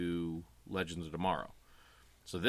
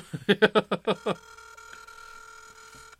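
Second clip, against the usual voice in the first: breathless laugh; 13 semitones higher, 4 dB louder than usual. Real, not acted. Laughing at the call.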